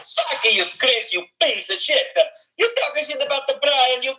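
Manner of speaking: Mario voice. Acted. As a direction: rant like a cartoon plumber. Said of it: "I'd rather do a Mario".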